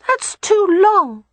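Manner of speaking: put-on high, slow, drawn-out child voice; wistful little boy. A narrator's reading. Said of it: "Rye said with a wistful sigh".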